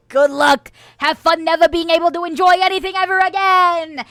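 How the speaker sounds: high, loud, hammed-up send-off; gleeful mock sentencing. Church host banishing Tea Time to hell, mock-gleeful. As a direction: crow the curse over the condemned.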